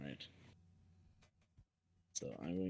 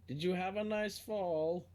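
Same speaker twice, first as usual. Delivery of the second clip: higher, louder mock-polite question; acted needling question; 11 semitones higher, 5 dB louder than usual. Player's character asks the fallen one, higher mock-polite. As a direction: ask it raised and pointed, in character. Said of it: "And I'm just like".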